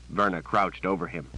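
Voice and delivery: usual speaking voice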